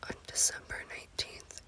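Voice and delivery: plain reading voice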